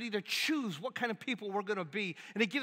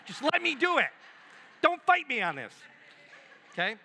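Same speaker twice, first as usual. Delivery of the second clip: higher, louder playful insistence; won't take no for an answer, hammed-up; pushy mock insistence; 6 semitones higher, 8 dB louder than usual. Giver at the grocery store insisting, mock-loud and higher.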